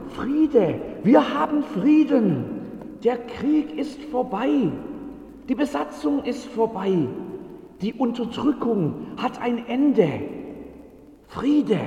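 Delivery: hoch und laut, freudig verkündend; jubelnder Bote. Acted. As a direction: die Nachricht atemlos hinausrufen. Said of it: "noch völlig außer Atem". (Bote verkündet jubelnd den Frieden)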